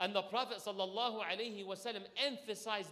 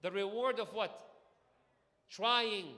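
ordinary delivery

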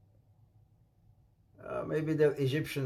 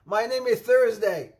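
laughing; 6 semitones higher, 5 dB louder than usual. Retold or imitated.

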